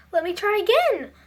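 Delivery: high, pleading elf; begging. A narrator's reading. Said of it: "begged the elf".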